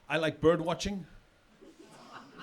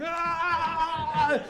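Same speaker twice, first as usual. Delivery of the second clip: high mock kid's shriek; tantrum scream; 9 semitones higher than usual. Imitating a tantruming kid at the park, high shriek. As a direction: shriek like a sugared-up angry toddler. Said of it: "one full of sugar and anger going".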